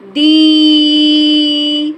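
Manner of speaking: दी slow, loud and stretched long; teacher stretching the long vowel; long drawn ee. Shown as a demonstration. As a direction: pull the ee of दी out slowly for children. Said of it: "देर तक बोलेंगे दी".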